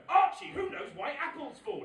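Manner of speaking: high, loud playful quiz call; parent quizzing toddler. Retold or imitated. Dad cueing his son's new trick, loud playful prompting. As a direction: call out the cue to a small child.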